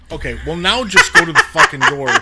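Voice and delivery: loud, high, rapid outburst; retold exasperated order. Parent on the phone ordering the kid to the door, exasperated. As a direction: order them to the door, fed up.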